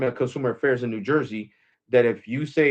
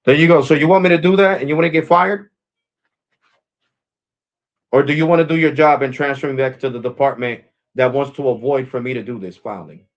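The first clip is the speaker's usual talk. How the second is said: loud, raised-pitch angry challenge; irate; 2 semitones higher, 7 dB louder than usual. Real, not acted. Irate customer challenging a phone agent who won't transfer him, angry. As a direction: confront them loudly, demanding action.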